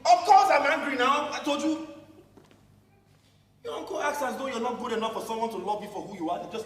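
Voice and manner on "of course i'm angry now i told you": loud angry retort; openly angry reply. Acted. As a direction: snap back at her, louder. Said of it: "Don't be angry".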